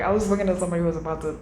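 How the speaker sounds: ordinary talk, nothing marked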